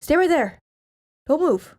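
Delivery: husband telling wife not to move during the football game, urgently loud; urgent command. Retold or imitated. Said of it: "he'd be like".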